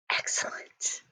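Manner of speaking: a soft whisper of praise. Acted. Teacher closes the lesson praising pupils, whispered. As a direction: breathe the praise like a secret.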